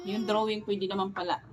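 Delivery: ordinary talk, nothing marked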